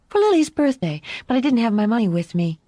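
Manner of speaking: put-on high, quick child's chatter; innocent little girl. A narrator's reading. Daughter explaining what she might buy, innocent and quick.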